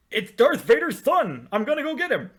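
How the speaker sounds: high-pitched, loud mock declaration; mock exclamation. Host mocks a villain's reasoning to co-host, high loud mock exclamation. Mock quote of a scornful character decision.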